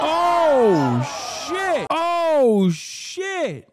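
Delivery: high loud excited yelp; real shocked hype; hyped shock. Real, not acted. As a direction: explode with delight at the clip. Hype reaction to the clip.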